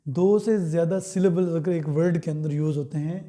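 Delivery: ordinary delivery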